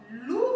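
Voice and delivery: "loup" said slowly with a rising vowel; "loup" held long and lifted at the end; demonstrated word. Shown as a demonstration. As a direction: draw out the "ou" in "loup" so it can be heard. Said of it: "Lorsque je dis le mot".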